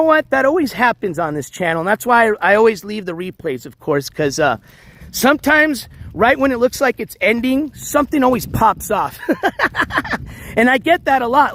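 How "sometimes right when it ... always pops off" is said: ordinary talk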